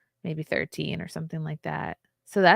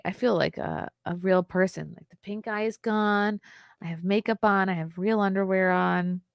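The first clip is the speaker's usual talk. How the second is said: ordinary delivery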